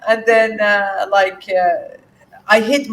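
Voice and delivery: said through real laughter, high and loud; laughing. Real, not acted. Sharing the joke with the listener, laughing through the words. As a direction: push the words out mid-laugh.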